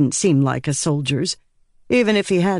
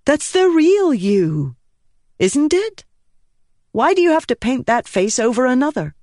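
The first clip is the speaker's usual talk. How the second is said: higher, put-on persona voice; adopted persona voice; 9 semitones higher than usual. Shallan taking on a persona, pitched higher. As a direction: slip into another self, lightly. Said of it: "as she adopted the persona".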